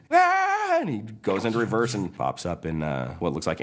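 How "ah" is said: high, loud mock scream; freak-out scream. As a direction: yell in cartoon panic. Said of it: "Mater freaks out".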